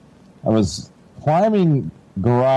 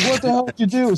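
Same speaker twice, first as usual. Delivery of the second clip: higher, exasperated mock yell of an annoyed dad; angry dad; 11 semitones higher than usual. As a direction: bark it like a fed-up father. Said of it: "he's more mad about".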